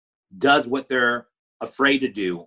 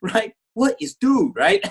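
excited; 10 semitones higher than usual. Retold or imitated. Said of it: "I'm like".

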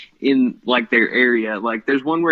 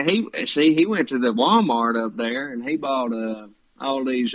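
Roy D Mercer. Retold or imitated. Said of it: "he does a hick voice".

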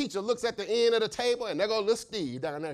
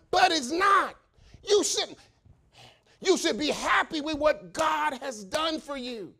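loud, high, shouted preaching; preaching at full force; 9 semitones higher, 4 dB louder than usual. Real, not acted. Preacher shouting at complacent servants, full force. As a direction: shout the rebuke at the congregation.

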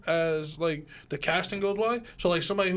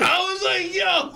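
excited; 12 semitones higher, 4 dB louder, much slower than usual. Retold or imitated. Excited retelling, shouting.

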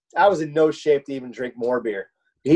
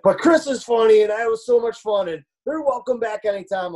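imitating dad; 7 semitones higher than usual. Dad telling his son the friends are welcome back. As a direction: play the pleased dad welcoming them back. Dad praising after a drunk night.

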